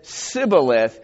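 "Sibboleth" with a plain s, not sh. Retold or imitated. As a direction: say "Sibboleth" with an s at the start. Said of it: "could not frame to pronounce it right".

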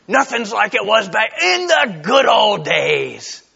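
a hammed-up loud pining for the past; mock nostalgic lament. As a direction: wail it like a grumbling old-timer. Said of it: "We're tempted to think".

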